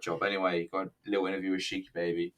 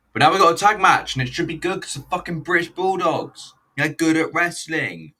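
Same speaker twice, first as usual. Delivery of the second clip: excited; 8 semitones higher, 8 dB louder than usual. Real, not acted. Hyped for the tag match.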